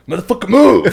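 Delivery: high, loud barked order; yelling drill instructor. Retold or imitated.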